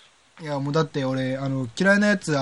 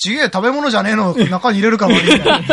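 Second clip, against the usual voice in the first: mock-angry rant, louder; heated rant; 5 semitones higher, 5 dB louder than usual. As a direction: get worked up over the mushrooms. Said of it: "キノコになったら途端にヒートアップする".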